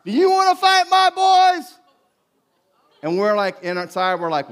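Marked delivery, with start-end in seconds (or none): 0.0-1.7 a high, loud challenge yelled from the car; dad yelling. Retold or imitated. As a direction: retell the father's yell, hammed up and loud. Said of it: "my dad yells from the car".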